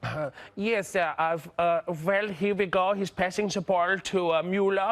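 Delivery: put-on Indian accent commentary. Comedian calling a ball game, mock Indian accent. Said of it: "no, this is India".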